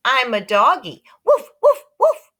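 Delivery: very high, loud puppy woofs; toddler playing dog. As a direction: yap like a tiny puppy. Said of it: "He said".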